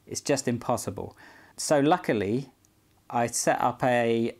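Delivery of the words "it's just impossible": ordinary delivery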